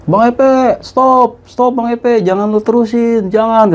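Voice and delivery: retold shout. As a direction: call out to stop him, half acting the yell. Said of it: "Mister R teriak dari atas nih". (teriakan tiruan yang lebih tinggi dan keras)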